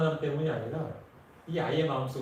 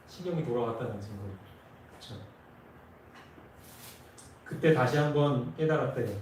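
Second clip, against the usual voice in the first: usual speaking voice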